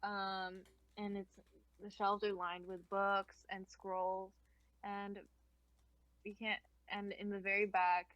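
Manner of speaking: ordinary talk, nothing marked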